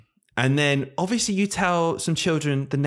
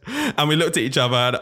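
ordinary delivery

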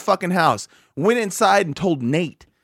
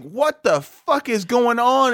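slow bewilderment; 6 semitones higher, slower than usual. Retold or imitated. Retelling the hallucination.

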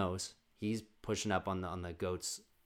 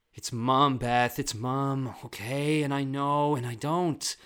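loud, higher hammed-up exasperation; TV drama outburst; 5 semitones higher, 10 dB louder, slower than usual. TV character arguing with Beth over Mom, outburst. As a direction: burst out at Beth, raised voice.

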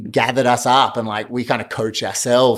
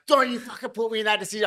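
retold angry outburst; 11 semitones higher, 5 dB quieter, faster than usual. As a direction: rattle off a retold scolding, high and softened. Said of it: "and I was off".

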